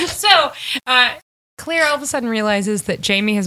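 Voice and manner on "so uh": bursting into loud laughter; laughing. Real, not acted. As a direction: laugh hard, then try to go on. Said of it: "now I'm laughing".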